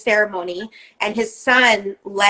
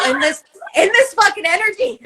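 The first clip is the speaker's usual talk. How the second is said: much higher, disbelieving outburst at herself; incredulous; 9 semitones higher than usual. Retold or imitated. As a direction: yelp it up high in disbelief. Acting out her own disbelief.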